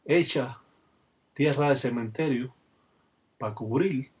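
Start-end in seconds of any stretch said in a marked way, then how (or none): none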